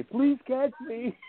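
high, drawn-out retold yell; mock plea stretched out high and slow; imitating panicked yell. Wrestler yells to his opponent in midair, imitated panicked yell. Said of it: "he's, like, yelling at me".

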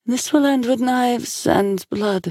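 high, slow, solemn foretelling; prophesying oracle. A narrator's reading. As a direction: draw the prophecy out, pitched high.

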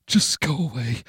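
high, hushed desperate plea; scared, high little voice; frightened pleading. Acted. Hiding figure begs not to be eaten.

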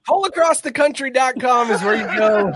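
loud, high, hammed-up promo read; loud announcer plug. Shown as a demonstration. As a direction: belt the web address like an announcer. Repeats plug loudly as joke.

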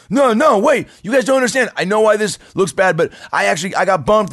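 high, loud frantic pleading; panicked pleading. Retold or imitated. Drunk guy pleading with his friends who drag him out, high and frantic. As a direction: beg them to listen, voice cracking up high.

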